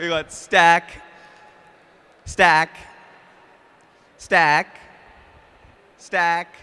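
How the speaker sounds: shouting the stacks, then smash; shouted game calls. Real, not acted. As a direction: call it out over the crowd. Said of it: "when I say smash".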